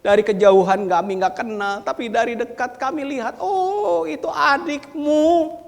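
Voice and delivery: loud, high-pitched preaching of the story. Acted. Preacher telling the parable at full voice. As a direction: preach it out to the congregation.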